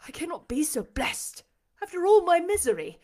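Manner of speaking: overwhelmed cry building in pitch; impassioned disbelief. A narrator's reading. Blind master doubting his happiness, passionately overwhelmed.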